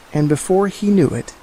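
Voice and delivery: usual speaking voice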